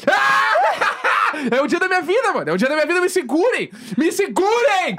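high fangirl-style screaming with laughter; ecstatic outburst. Real, not acted. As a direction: lose it completely with joy. Favourite band announced, overjoyed fan.

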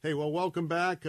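normal talking voice

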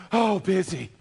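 a puffing, winded "busy" as if fresh off the stairs; mock out of breath. Preacher mocking a churchgoer, out of breath. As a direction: gasp it out like you just ran up a flight of stairs. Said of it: "you sound like you just ran up a flight of stairs".

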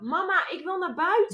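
put-on high nagging kid voice; a small child begging to go outside; child whining to mum; whiny child.

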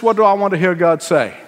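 normal talking voice

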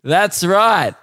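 drawn-out mock accusation; smug heckler. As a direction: announce it like a proud heckler. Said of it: "he goes".

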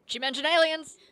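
high, loud thrilled outburst; excited exclamation. Real, not acted. Telling friend the ranger mentioned aliens, thrilled and loud. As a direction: burst out delighted.

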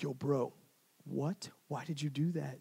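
hushed, acted dismay; quiet disbelief. Bystander reads a friend's sins, quietly appalled. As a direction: ask it softly, as if appalled at a buddy. Acts out shocked reaction to sins.

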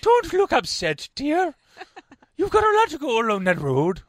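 high, loud, exaggerated Irish-Scottish lilt; mock Irish accent. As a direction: imitate her with a shrieky Mrs Doubtfire brogue. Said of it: "That was more Irish, actually, not Scottish".

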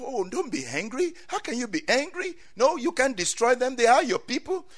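high-pitched, insistent protest; pleading. Acted. Moses arguing with God for his people, pleading. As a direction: plead with a rising, urgent pitch. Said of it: "And Moses started pleading with God".

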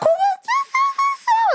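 squeaky high laughing break, very drawn out; high laugh cracking through her own words. Real, not acted. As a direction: let the laugh take the words. Bursts out laughing at own story.

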